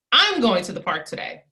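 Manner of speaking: I'm pressed hard, then falling; I'm going to the park today, with the weight on I'm; stress on I'M. Shown as a demonstration. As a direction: lean on the word I'm, as in me and nobody else. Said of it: "I'm gonna emphasize it three different times".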